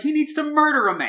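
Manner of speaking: high, loud egging-on; root for the villain with gleeful glee; gleeful cheering. Retold or imitated. Urging on the villain in this movie, high and loud. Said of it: "And in this one, I'm like".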